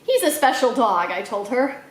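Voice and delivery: ordinary delivery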